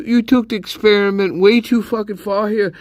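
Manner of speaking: ordinary delivery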